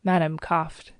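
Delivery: plain reading voice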